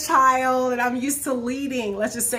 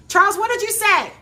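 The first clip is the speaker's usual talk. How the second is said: loud, high call across the house; raised voice, prompting him to answer; 10 semitones higher, 7 dB louder than usual. Real, not acted. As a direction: holler to someone downstairs. Calls to husband off camera.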